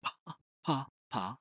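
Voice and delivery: "Pa, pa, pa" with a popping p; p drill. Shown as a demonstration. Teacher contrasts P with F for a trainee. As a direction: pop the p on each "pa". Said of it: "difference between the P and F".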